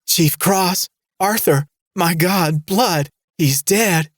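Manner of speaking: high, shrill panicked screams; hysterical screaming. A narrator's reading. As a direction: shriek in horror at the blood. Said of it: "She was screaming again".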